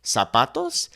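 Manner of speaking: "zapatos" with a clear S; Latin American accent. Shown as a demonstration. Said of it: "we would simply make that S sound".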